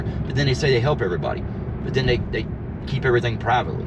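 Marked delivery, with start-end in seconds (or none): none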